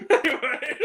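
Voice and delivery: usual speaking voice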